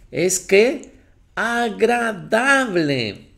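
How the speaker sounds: high, loud pulpit voice building to its peaks; fervent preaching. Acted. Pastor preaching to his church, high and loud. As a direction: preach it out to the congregation.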